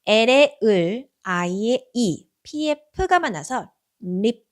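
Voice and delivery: each letter sound drawn out slowly. Shown as a demonstration. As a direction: sound out every letter, slow and clear. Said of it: "립이라고 읽어주시면 되고". (글자 소리를 천천히 늘여서)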